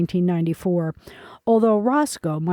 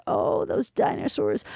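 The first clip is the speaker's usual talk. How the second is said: slow, lower, whispered repeat of "those dinosaurs"; mock reverent whisper; 5 semitones lower, 4 dB quieter than usual. A narrator's reading. Roscoe teasing the librarian's romantic talk, mock whisper. Roscoe mocking the museum displays.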